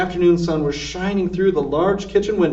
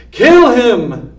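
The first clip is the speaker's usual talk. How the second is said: loud, high furious shout; Cook shouting; 7 semitones higher, 11 dB louder than usual. A narrator's reading. Cook ordering Mig to kill the mouse, furious loud shout. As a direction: bellow the order. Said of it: "shouted Cook".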